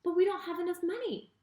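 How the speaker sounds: a touch of panic in the disciples' voice; mild panic. Acted. Jesus' friends panicked.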